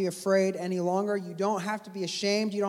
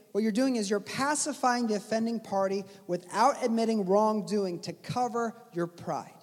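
ordinary delivery

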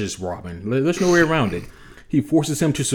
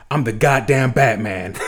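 Batman line. Retold or imitated. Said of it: "Then he goes".

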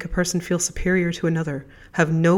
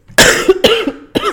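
cough; 12 dB louder than usual. Real, not acted. Sick host coughs mid-sentence.

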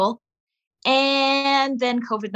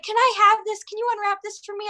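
put-on much higher pleading child voice; little boy asking; 9 semitones higher, faster than usual. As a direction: ask like a kid begging for something from the purse. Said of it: "He's like".

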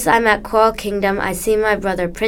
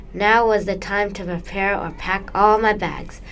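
plain reading voice

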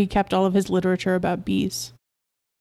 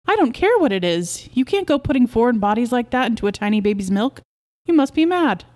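higher-pitched outraged protest; indignant; 4 semitones higher than usual. Acted. Wife forbids foreign bodies in baby's milk, indignant. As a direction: scold him, pitch climbing at the end.

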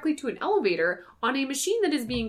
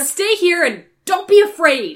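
loud, slow captain's order; mock commanding shout; 7 semitones higher, 10 dB louder than usual. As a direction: bark it out slowly like a captain. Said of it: "Kirk's instructions to everyone are just".

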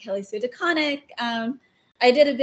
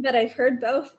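usual speaking voice

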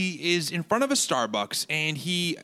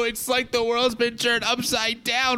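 hammed-up fake sobbing, high and wobbly; a horrendous mock weep, pitch cracking upward; fake cry; 5 semitones higher, slower than usual. Host fake-crying to his co-hosts over Starbucks, hammy sobbing. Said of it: "Your fake cry is horrendous".

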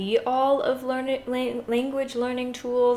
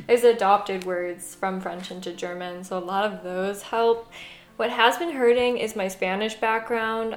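ordinary delivery

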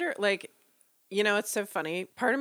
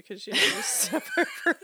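laughing; 7 semitones higher than usual. Real, not acted. Laughing at the ghost story.

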